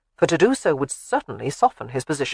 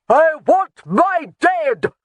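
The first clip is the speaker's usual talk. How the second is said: slow, high, loud roar; 11 semitones higher, 6 dB louder, much slower than usual. A narrator's reading. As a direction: roar like a wounded bear. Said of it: "roaring like a wounded bear".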